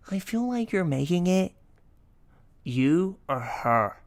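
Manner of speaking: drawn-out, aggrieved complaint; mock drama. Girlfriend arguing with boyfriend in bed, mock dramatic complaint. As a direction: stretch out the accusation. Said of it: "I turn into a drama queen".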